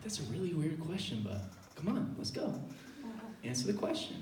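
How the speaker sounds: very soft, low urging; muttered aside. Acted. Kid urging friend to answer the question, muttered quietly. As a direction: keep it under your breath. Aside under his breath while quizzed.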